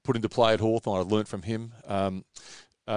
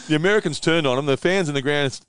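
ordinary talk, nothing marked

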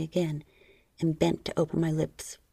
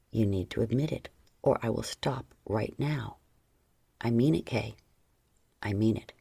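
low stern man voice; 8 semitones lower than usual. Acted. John's stern threat.

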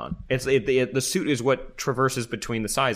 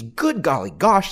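mock amazement; 4 semitones higher, 6 dB louder, slower than usual. Hammy ad exclamation.

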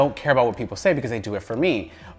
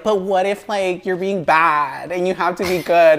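higher, nagging mock objector; devil's advocate voice; 6 semitones higher, 4 dB louder than usual. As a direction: put on a second-guesser's voice. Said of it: "the voice is so good".

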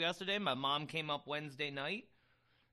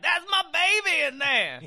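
put-on desperate young guy; 12 dB louder than usual. Retold young guy pleading.

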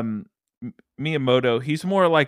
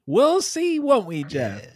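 higher, teasing singsong threat; mock ominous tease; 8 semitones higher, slower than usual. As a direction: lean in like a villain taunting Jeff.

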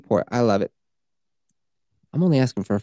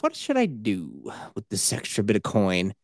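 mock listener voice; 5 semitones lower, 3 dB quieter than usual. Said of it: "you might think to yourself".

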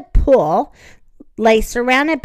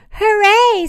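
high-pitched hooray; cheering; 9 semitones higher, 4 dB louder than usual. A narrator's reading. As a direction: cheer with a lifted voice. Mom cheers Victor's landing.

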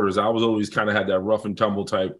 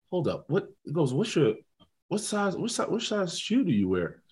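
put-on much softer, sizing the kid up; hushed coach voice; 4 semitones higher, 8 dB quieter than usual. Coach sizing up a freshman, much softer.

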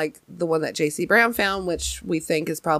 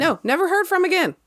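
high dramatic echo; 11 semitones higher than usual. Pretend.

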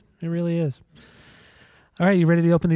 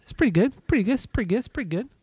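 high teasing repeat; 8 semitones higher, faster than usual. Pretend.

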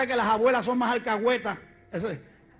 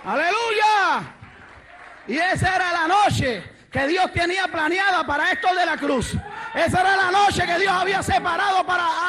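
raised, fervent sermon shout; full-force preaching; 5 semitones higher than usual. Real, not acted. Preacher proclaiming God's plan to the congregation, full-force preaching. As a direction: preach it out, stretching the big endings.

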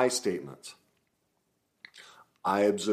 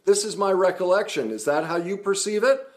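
high, earnest "wait a minute"; calm inquiry; 10 semitones higher, 5 dB louder than usual. Shown as a demonstration. Coach demoing the right way, earnest and raised. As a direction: check their view openly. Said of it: "I'm going".

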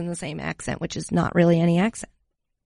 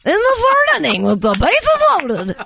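high, loud baseball announcer call, half-laughing; a lightly done old Cubs announcer calling the game; Harry Carey impression; 6 semitones higher, 3 dB louder than usual. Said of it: "like Harry Carey".

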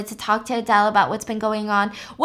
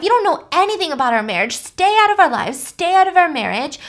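raised, heated voice telling him off; higher and louder, mock outrage; angry retold rant; 5 semitones higher, 4 dB louder than usual. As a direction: scold her like a furious lover. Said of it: "He's like".

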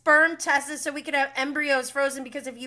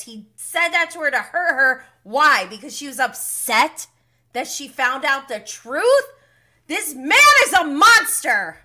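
outraged rant; 3 semitones higher, 7 dB louder than usual. Real, not acted. Podcast host ranting at Sandoval, loud and outraged. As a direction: fume at him like he's in the room.

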